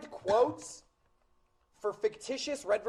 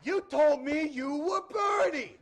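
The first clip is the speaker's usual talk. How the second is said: slow, loud, high Boston accusation; thick Boston accent; 6 semitones higher, 5 dB louder, slower than usual. Retold or imitated. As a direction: accuse him as a Boston kid. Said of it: "talks with a thick Boston accent".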